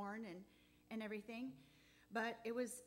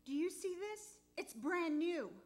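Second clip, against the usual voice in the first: higher, louder amazed exclamation; 6 semitones higher, 6 dB louder than usual. Retold or imitated. Showing her husband the new dowel, amazed. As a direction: show him the wonder in it. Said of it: "And I said".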